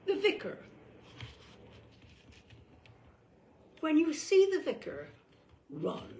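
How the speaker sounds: high, loud, ominous announcement; dramatic warning. Acted. Warning listeners that the vicar is coming, loud and high. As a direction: ring the alarm about the vicar.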